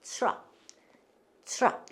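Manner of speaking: English-style 트럭, set apart for repeating; corrected English pronunciation. Shown as a demonstration. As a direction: say 트럭 as "chruck", t-r blended. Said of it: "뭐라고 읽어요".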